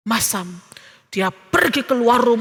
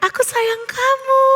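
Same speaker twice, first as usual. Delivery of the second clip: high, softened repeat of a call; echo; 9 semitones higher than usual. A narrator's reading. Valley echo answers Theo's loving call, echoing. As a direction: send it back like an echo.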